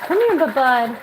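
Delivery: high, sing-song call to the bird; pet talk. Real, not acted. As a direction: coax the pet over in a high voice.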